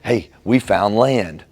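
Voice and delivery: slow, upbeat, proud announcement; turning the alarm into a happy discovery; cheerful spin. Acted. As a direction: spin bad news into good news. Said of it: "we say".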